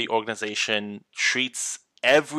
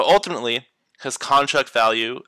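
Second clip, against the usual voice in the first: ordinary delivery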